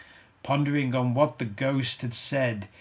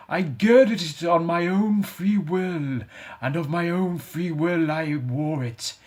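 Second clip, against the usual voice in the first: mournful ghost; 5 semitones higher, 3 dB louder than usual. Acted. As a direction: grieve for the wasted life. Ghost explaining its chain.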